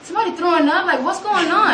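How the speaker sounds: high, loud baffled alarm. Retold or imitated. Teacher hearing weird noise in class, alarmed. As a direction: react startled, pitched well up. Retold startled reaction to noise.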